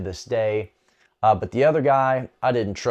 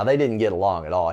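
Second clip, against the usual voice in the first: usual speaking voice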